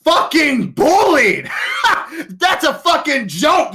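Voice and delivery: high, loud and raw, with a laughing crack; furious yell. Acted. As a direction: spit it out as a furious, mocking outburst. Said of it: "as he says".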